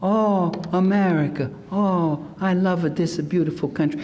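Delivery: lightly voiced adoring foreigner; gushing immigrant. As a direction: gush with mock adoration. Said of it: "didn't find people saying".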